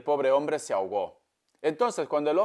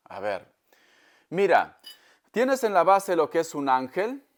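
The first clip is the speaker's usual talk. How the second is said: normal talking voice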